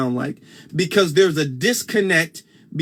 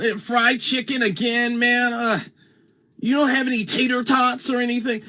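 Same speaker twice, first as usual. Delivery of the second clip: higher-pitched mock-proper complaint; 6 semitones higher than usual. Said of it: "when people speak that way".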